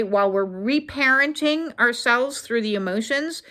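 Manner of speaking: normal talking voice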